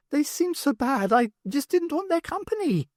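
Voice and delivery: lightly voiced alien. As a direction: state it like a strange being. Thu's alien voice.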